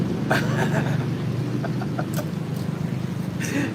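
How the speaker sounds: rising wheezy laugh; high breathless laugh. Real, not acted. Cracking up at his own joke, a high wheezing laugh. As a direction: squeal of silent laughter up high.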